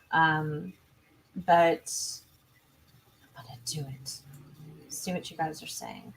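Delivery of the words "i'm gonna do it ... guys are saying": a private whisper to herself while opening the chat; whispered aside. Real, not acted. Streamer whispering to herself as she opens the chat. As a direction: breathe it out under her breath, almost to nobody.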